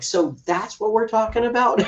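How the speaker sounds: high, disbelieving question; mock disbelief at the kid's excuse; incredulous. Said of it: "And I'm like".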